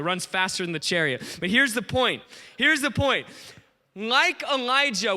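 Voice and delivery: usual speaking voice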